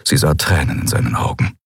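usual speaking voice

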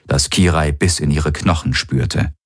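ordinary delivery, nothing marked

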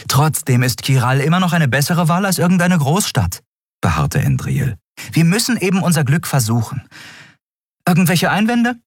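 viel höher, bestimmt und zügig; beharrliche Endriel. Acted. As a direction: die Gruppe überzeugen. (hoch und entschlossen)

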